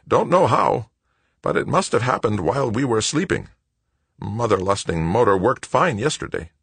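high-pitched exasperated mechanic; exasperated Wojo. A narrator's reading. Wojo complains of the motor.

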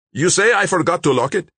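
high, loud, indignant question; indignant gunman. A narrator's reading. Gunman challenges his partner, indignant and high.

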